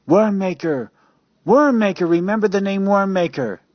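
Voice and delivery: high, loud desperate yell; frantic shouting into the phone; blurted shout. Acted. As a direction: blurt the name out before the line goes dead. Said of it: "he blurts out".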